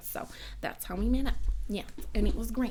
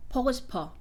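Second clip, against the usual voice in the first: short, even Seoul Korean phrase; Seoul dialect; much faster than usual. Shown as a demonstration. Contrasting the two dialects for viewers, Seoul dialect. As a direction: say it with plain Seoul intonation. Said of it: "in the Seoul one, it was like".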